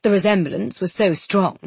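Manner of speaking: ordinary delivery, nothing marked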